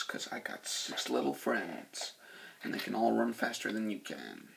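menacing whisper. Retold or imitated. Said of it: "talking to the chick going".

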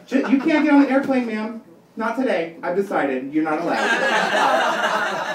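high, smug agent refusing you; mock gate agent. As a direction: lay down the law with fake authority. Mocking gate agent refusing boarding.

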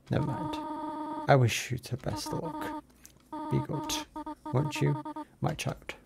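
put-on like a worried mother warning her child; gentle motherly goat voice. Reads Toriel's lines in a voice.

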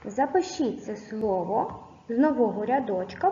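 normal talking voice

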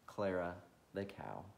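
ordinary delivery

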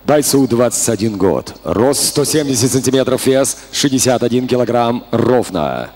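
low, steady stats readout; announcer stat read. Real, not acted. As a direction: read the tale of the tape. Announcer reads fighter stats.

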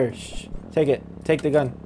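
ordinary talk, nothing marked

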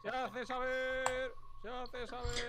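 slow, high proclaiming call; town crier. Pretend. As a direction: announce it like a herald. Medieval proclamation in the game.

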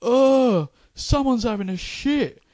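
loud, high, slow call across the dinner queue; schoolboy calling out the smell; mock tattletale call. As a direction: announce it to everyone, slowly and loudly. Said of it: "started going".

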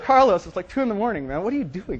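loud, high mock exasperation; exasperated protest. Woken consultant protests to client, loud exasperation.

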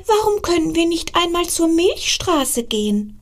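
put-on high, bright child voice asking mum; a small star pleading with his mother; little star child. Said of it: "fragte er seine Mutter".

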